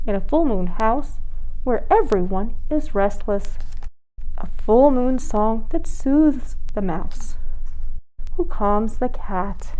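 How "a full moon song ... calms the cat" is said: slow, gentle storybook lull; hushed slow bedtime read; soothing bedtime reading. A narrator's reading. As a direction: ease them toward sleep.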